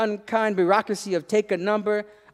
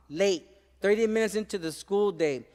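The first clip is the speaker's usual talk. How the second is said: plain reading voice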